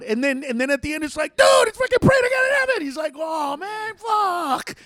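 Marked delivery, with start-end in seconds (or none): 1.4-2.8 high, loud yell at a clueless teammate; acted screaming frustration; exasperated shout. Acted outburst revealing the answer. 3.2-4.7 high, drawn-out groan of defeat; mock howl of regret; frustrated cry. Acted Bert's reaction to losing.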